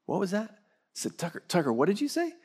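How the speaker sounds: mildly baffled dad, pitched high; puzzled questioning. Retold or imitated. Dad asking his toddler to repeat himself, puzzled.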